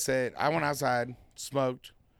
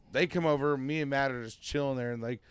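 ordinary talk

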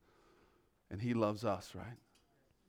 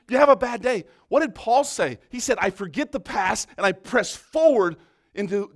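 louder, higher preaching, pressing; preaching at full force; 5 semitones higher, 10 dB louder than usual. Real, not acted. Pastor urging congregation not to dwell on past mistakes, preaching loudly. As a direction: preach it out hard.

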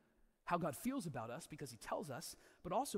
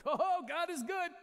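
high, slow, sing-song mock praise; mock gushing praise; 11 semitones higher, 6 dB louder, slower than usual. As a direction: ham up a thrilled churchgoer's gushing. Mock churchgoer in parking lot.

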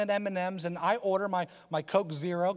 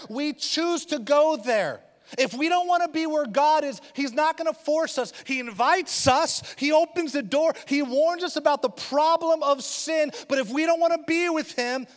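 louder, higher preaching at full force; 10 semitones higher, 8 dB louder than usual. Acted. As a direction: drive the conclusion home to the church. Preaching conclusion.

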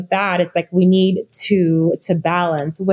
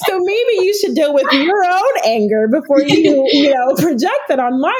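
real laugh spilling into a higher, brighter line; laughing through words; 7 semitones higher than usual. Real, not acted. Laughing at herself, retelling a spat with her husband. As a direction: laugh at yourself while you finish the thought. Laughing at own argument story.

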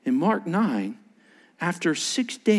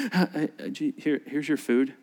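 soft, lower, stumbling; awkward stammer; 4 semitones lower, 6 dB quieter than usual. Retold or imitated. Overwhelmed disciple serving Jesus food, awkward. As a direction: stammer it out, suddenly subdued. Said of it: "act when you're overwhelmed by something".

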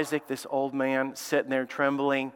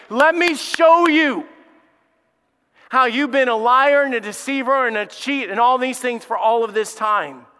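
voice of God confronting; 7 semitones higher, 6 dB louder than usual. Acted.

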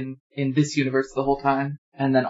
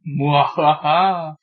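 slow, drawn-out villain cackle; evil laugh; much slower than usual. Pretend. Villain laugh after nightmares.

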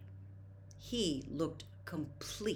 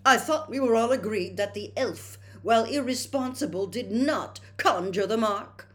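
louder, higher, heated pompous retort; 6 semitones higher, 9 dB louder than usual. A narrator's reading. Percy snapping back at his father, heated and loud. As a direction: bristle at the accusation, louder. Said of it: "said Percy hotly".

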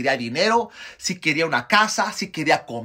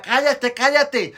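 high, raised crowd rebuke; imitated yell, pitched up; crowd silencing him; 9 semitones higher, 4 dB louder, slower than usual. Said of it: "la gente decía".

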